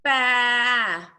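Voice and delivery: loud, open drawn-out baa; sustained baa with a falling end; sheep baa. Shown as a demonstration. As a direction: call out a long baa. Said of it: "make the same sound as me".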